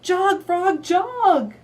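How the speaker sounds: high, loud cheering chant; excited cheer. Shown as a demonstration. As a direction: cheer the frog on.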